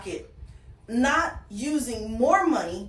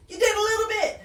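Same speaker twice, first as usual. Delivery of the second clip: high-pitched, playful mock cheer; mock congratulating; 10 semitones higher, 4 dB louder, faster than usual. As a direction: cheer a tiny win in a much higher voice. Teasing congratulations on small progress.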